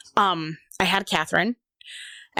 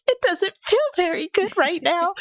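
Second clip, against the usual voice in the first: high, strained reply; 12 semitones higher than usual. Retold or imitated. Her own reply while crying.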